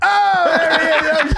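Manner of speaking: high, loud, laughing hype-man cheer. Retold or imitated. Hype team greeting the guy in shades, high laughing cheer.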